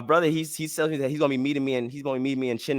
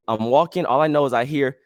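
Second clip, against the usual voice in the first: usual speaking voice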